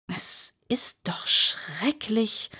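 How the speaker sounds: slow whispered grumble; Alice whispering to herself, slowly; murmured to herself. Acted. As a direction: mutter it under your breath, slowly. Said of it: "murmelte sie vor sich hin".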